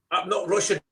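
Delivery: a hint of Scouse Eastern European boy; Scouse Eastern European accent. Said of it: "Scouse Eastern European accent".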